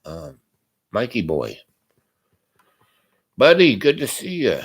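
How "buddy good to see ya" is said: lightly voiced jovial buddy, higher and louder; a hint of a back-slapping pal calling out; hearty buddy greeting. Mock greeting from Mikey Boy.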